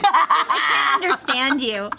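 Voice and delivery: high-pitched, teasing incomprehension; mock baffled listener. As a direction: tease through laughter, acting unable to understand. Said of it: "Funny accent you've got".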